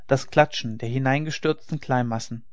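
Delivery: plain reading voice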